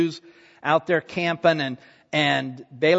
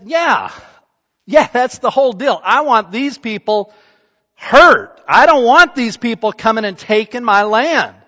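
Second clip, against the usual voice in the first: loud, raised voice, acting out an adamant king; vehement demand; 3 semitones higher, 6 dB louder than usual. King tells prophet he wants these people hurt, acted vehemence. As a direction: hammer it out, building to a hard punch.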